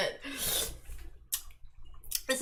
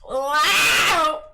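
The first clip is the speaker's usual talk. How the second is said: surprised shout; 3 semitones higher, 9 dB louder than usual. Real, not acted. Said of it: "Why did you sound like you were about to say Wobbuffet?".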